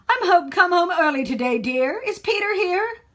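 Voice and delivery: put-on little boy putting on a grown-up dad voice, lightly; boy faking dad. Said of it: "Peter pretended that he was his father".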